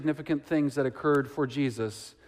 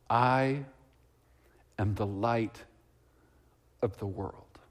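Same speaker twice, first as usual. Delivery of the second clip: hushed words of Jesus; 4 semitones lower, 3 dB quieter, slower than usual. Shown as a demonstration. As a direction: lower and softer, falling away to a whisper on "of the world". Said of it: "A few simple words from Jesus".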